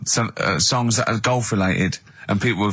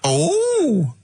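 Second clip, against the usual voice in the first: high, drawn-out exclamations; hammed-up comedy character; 9 semitones higher, slower than usual. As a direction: announce a silly stock character in a high camp voice. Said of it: "You'd have crazy comedy characters".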